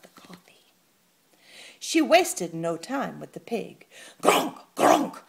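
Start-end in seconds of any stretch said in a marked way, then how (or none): 4.2-5.2 whispered pig grunts; a breathy little grunting squeal. Acted. Pig squealing over the coffee on his shoes. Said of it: "squealed Don Cerdo".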